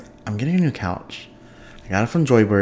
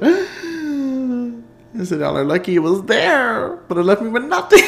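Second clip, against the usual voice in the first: gasp, then a much higher, louder laughing rant; shocked laughing gasp; 4 dB louder, slower than usual. Retold or imitated. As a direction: laugh through the shock of an emptied bank account. Retelling shock at emptied account.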